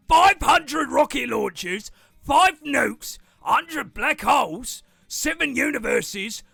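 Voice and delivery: loud, high merchant yelling; merchant shouting. Acted. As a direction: merchant bellows, then gets thrown. Merchant offers gifts, then yelps.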